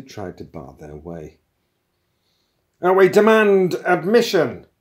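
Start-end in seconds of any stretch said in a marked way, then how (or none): 2.8-4.7 high, loud protesting yell; shouted demand. A narrator's reading. Intruder forcing his way into the room, shouting. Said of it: "shouted the younger of the two men".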